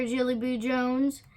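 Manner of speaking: usual speaking voice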